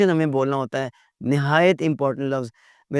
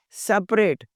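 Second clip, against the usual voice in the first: ordinary talk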